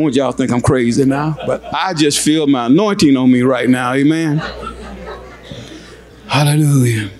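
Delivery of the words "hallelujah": ordinary talk, nothing marked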